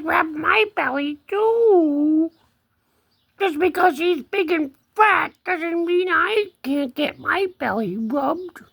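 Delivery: high-pitched kitty plea; cat voice. Pretend. Big cat wanting a belly rub, high cat voice. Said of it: "He says".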